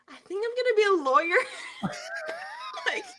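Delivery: quick, high-pitched quote breaking into laughter; barely holding back a big laugh; laughing self-quote. Retold or imitated. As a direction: quote yourself and crack up. Cracks up quoting her own answer.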